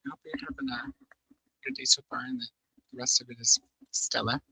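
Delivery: gentle whisper. Real, not acted. Whispered sign-off to viewers.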